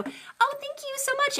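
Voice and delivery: high, squealy thank-you to a viewer; light up and gush your thanks; delighted thanks. Real, not acted. Thanking a chat viewer for a gift, high and delighted.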